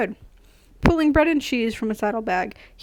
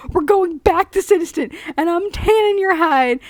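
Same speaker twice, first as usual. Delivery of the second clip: loud, high, bad Scottish scolding; badly done Scottish accent, raised and angry; attempted Scottish accent; 6 semitones higher, 6 dB louder than usual. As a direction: roar it in a shaky attempt at Scottish. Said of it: "I meant to sound Scottish".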